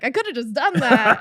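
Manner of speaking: loud, cocky brag; boastful quip. Retold or imitated. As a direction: brag it off the cuff. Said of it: "I was like".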